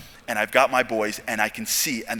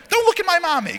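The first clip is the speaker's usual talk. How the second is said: put-on much higher voice of a small boy protecting mom; little boy; 4 dB louder than usual. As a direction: play an eight-year-old standing guard. Little boy defends his mother.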